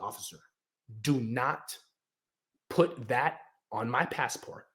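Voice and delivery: low, hushed, measured appeal to an officer; quiet plea. Retold or imitated.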